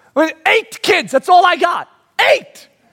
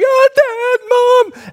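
put-on high, loud excited child greeting; imitating a child running up to parents; excited kid; 13 semitones higher, 4 dB louder, much slower than usual. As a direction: squeal it like a kid seeing Dad. Kids greeting parents home.

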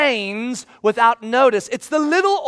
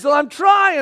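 higher, louder defensive whine; plead, fed up and stretched thin; exasperated; 6 semitones higher, 5 dB louder than usual. Retold or imitated. Husband exasperated reply.

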